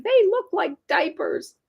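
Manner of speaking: put-on high, whiny kid frog protest; high-pitched childish whine; whiny complaint. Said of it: "whined Froggy".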